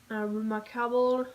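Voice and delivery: "Remarkable" said in an English accent; hammed-up English "Remarkable". Shown as a demonstration. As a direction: give "Remarkable" an English twang. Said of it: "j'aime trop mon accent".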